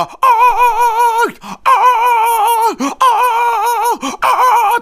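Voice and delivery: very high, loud stretched "ah"; high rock-star wail. Retold or imitated. The rock star wailing back at the duo. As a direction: let out a piercing held cry. Trading cries.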